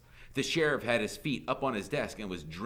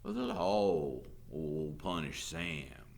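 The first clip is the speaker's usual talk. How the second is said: very slow, heavy Southern drawl; drawling sheriff; 4 semitones lower, much slower than usual. A narrator's reading. Sheriff warning the departing teacher, drawling. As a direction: draw the threat out lazily.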